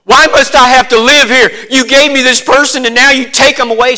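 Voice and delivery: loud, high accusing lament; bitter mourner. Retold or imitated. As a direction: hurl the blame at God. Mourner blaming God.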